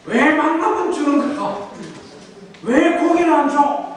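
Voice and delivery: high, drawn-out acted grumbling; indignant whining demand; resentful complaining. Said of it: "불평하고 원망하는구나".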